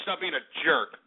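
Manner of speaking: ordinary talk, nothing marked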